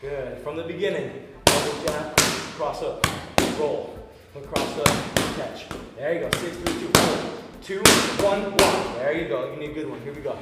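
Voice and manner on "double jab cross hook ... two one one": calling combos. Shown as a demonstration. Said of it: "any cues that I have, you guys are gonna hear".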